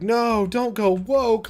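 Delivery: high, drawn-out mock plea; mock pleading. Imitating band fans complaining on Facebook, mock pleading. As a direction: stretch it out high like a dismayed fan.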